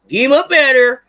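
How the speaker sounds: loud repeated order. Real, not acted. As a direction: yell it to chase the ad away. Repeats it louder to banish ad.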